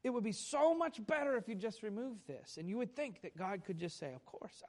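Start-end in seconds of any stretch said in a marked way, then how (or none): 4.1-4.6 soft reply. Acted. God's imagined easy answer.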